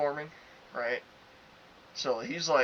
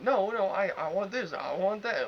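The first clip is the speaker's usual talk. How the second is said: higher-pitched mock insisting; imitated demanding customer; 7 semitones higher than usual. Customer arguing for more prizes, imitated insistence.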